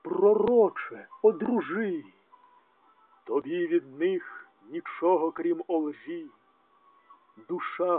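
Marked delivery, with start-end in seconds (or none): 0.0-2.0 quoted plea. Acted. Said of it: "Чом кажеш ти".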